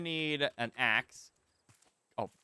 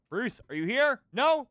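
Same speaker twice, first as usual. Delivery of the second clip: calling out; 8 semitones higher, 7 dB louder than usual. Real, not acted. Calling out for the shark.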